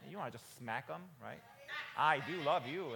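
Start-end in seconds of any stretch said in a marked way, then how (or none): 2.0-2.9 loud reply. Retold or imitated. Parent answering child's complaint.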